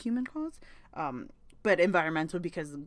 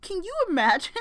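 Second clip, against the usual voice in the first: aghast exclamation; 12 semitones higher, 7 dB louder, faster than usual. Real, not acted. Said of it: "So I'm like".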